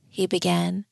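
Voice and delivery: plain reading voice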